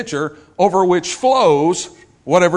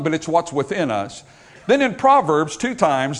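ordinary talk, nothing marked

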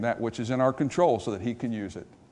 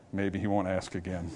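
softer, lower, hoping not to be noticed; hushed hoping; 4 semitones lower, 6 dB quieter than usual. Pretend. Peter hoping Jesus won't ask again, hushed and low. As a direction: mutter it under your breath, ducking.

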